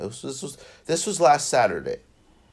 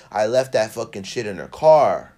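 ordinary talk

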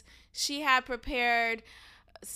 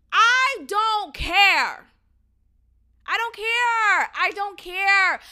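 loud, hammered I don't care; a rising, furious outburst; angry repeated refusal; 5 semitones higher, 7 dB louder, slower than usual. Real, not acted. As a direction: slam each I don't care down, fed up. Ranting about the cancellation.

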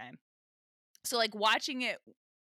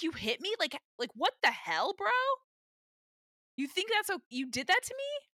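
aghast betrayal; 9 semitones higher than usual. Retold or imitated. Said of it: "aghast and betrayed by Michael".

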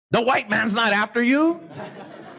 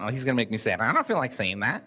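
reluctant subconscious; 10 semitones lower, 5 dB quieter, faster than usual. Retold or imitated. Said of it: "your subconscious right now is going".

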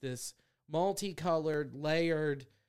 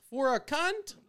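barista call-out, louder; 10 semitones higher, slower than usual. Retold or imitated. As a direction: call the name out across the counter. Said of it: "like I'm ordering something at fucking Starbucks".

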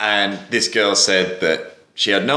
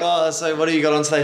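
higher, fast, breezy put-on voice; mimicking Matt; 6 semitones higher, faster than usual. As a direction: toss it off quickly, fake casual. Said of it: "Matt's like".

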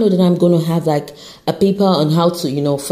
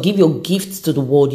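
ordinary talk, nothing marked

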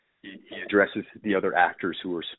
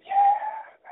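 triumphant shout. Real, not acted. Triumphant yell after quote.

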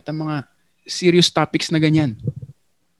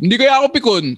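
mock defensive voice; 11 semitones higher, 5 dB louder, faster than usual. Mocking thinkers' defensive quote.

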